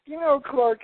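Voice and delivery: a lightly mocking squeaky impression of the co-host; high mock co-host voice.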